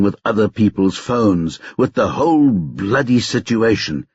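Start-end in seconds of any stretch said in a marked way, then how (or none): none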